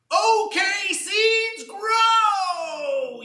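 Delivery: very high, loud yell, drawn out on grow. Acted. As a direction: bellow it into the wind, fed up. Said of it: "Even louder into the wind".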